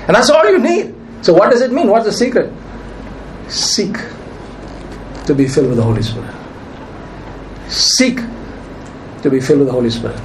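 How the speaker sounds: usual speaking voice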